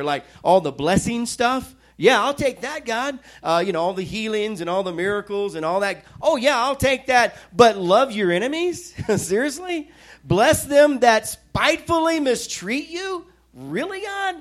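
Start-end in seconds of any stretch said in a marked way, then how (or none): 2.0-3.2 higher, eager grab at the blessing; snatch it up gleefully, pretending; mock eager. Mocking picking blessings. 6.2-7.4 higher, eager grab at the blessing; snatch it up gleefully, pretending; mock eager. Mocking picking blessings. 7.5-14.4 higher, incredulous protest to God; as if it can't be serious; mock disbelief.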